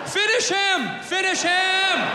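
high, drawn-out call to finish; mock fight announcer. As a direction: call it out like a fight game, stretching the end. Imitating a fight game announcer's call.

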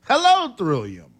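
ordinary talk

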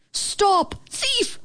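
very high barked yelp. A narrator's reading. Dog chasing the bug, high yelp. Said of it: "Max barked".

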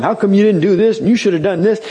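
a hint of someone scolding God; indignant complaint. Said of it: "I'm gonna tell him".

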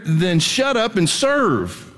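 slow, blunt preacher's order; blunt slow command. Real, not acted. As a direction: shut up and serve spoken slowly, serve falling long. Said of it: "I love saying "shut up".

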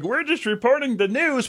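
mocking a prim news anchor, pitched high and soft; lightly sneering newsreader impression; mock newscaster. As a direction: put on a prissy high anchor voice. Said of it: "they're like".

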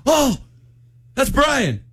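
high, thrilled recognition; excited. Retold or imitated. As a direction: blurt it out like spotting a star. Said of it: "And I got excited".

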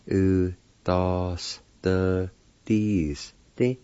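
"U, da, s, the, dis, di" in separated syllables. Shown as a demonstration. Teacher repeating the word for penalty, syllable by syllable. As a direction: sound out each part deliberately. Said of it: "This pronounced like this".

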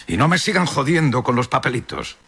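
high, sustained yell without much added volume; narrated full-voiced shout. A narrator's reading. Mayor shouting over the railing to the whole town, high-pitched yell. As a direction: call it out high for all to hear. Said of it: "gritó con todo el poder de su voz".

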